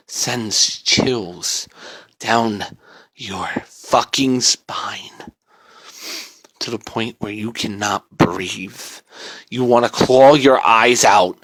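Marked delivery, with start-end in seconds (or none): 0.1-8.8 slow whisper; eerie whisper; chilling whisper. A narrator's reading. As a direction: breathe it out slowly. Creepy description of chills.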